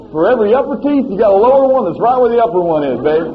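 loud, impassioned preaching; preaching at full force. Acted. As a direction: drive it home to the congregation.

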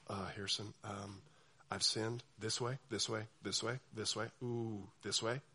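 low, soft, embarrassed mumble; sheepish confession. Retold or imitated. Churchgoer confessing sins to pastor, quiet sheepish. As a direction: confess meekly under your breath.

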